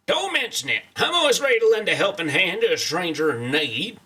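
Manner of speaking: folksy, helpful cowboy voice; cowboy character read. Acted. Streamer reading game dialogue aloud to cover missing voices, cowboy voice. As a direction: play the obliging cowboy stranger. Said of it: "desperately trying to sound good".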